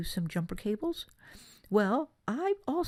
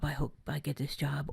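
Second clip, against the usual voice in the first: "I hope I get this job" dropped softer, like a quiet wish; softer wishful repeat; 6 semitones lower, 7 dB quieter than usual. Shown as a demonstration. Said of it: "I've said".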